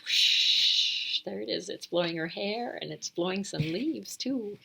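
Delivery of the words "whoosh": breathy whispered whoosh; airy wind sound. Acted. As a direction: blow it out like a breeze. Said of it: "and it whispers".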